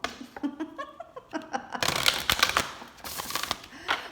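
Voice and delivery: breathy laugh. Real, not acted. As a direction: laugh it off, delighted. Laughs at her own reading.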